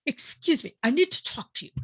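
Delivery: high-pitched mock Irish husband; Irish husband imitation. Husband interrupting wife's phone call, mock Irish imitation. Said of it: "this is my imitation".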